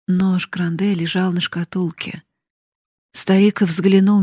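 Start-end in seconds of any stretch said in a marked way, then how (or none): none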